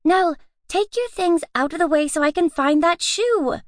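high, rushed and frantic; flustered chatter. A narrator's reading. Louise hunting her shoe in a fluster, frantic. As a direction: rattle on while hunting a lost shoe.

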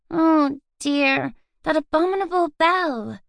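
put-on drowsy girl's voice, pitched high; sleepy murmur. A narrator's reading. Louise murmuring at the rising bell, sleepy. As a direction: mumble it half asleep into the pillow. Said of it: "turn sleepily on her pillow and murmur".

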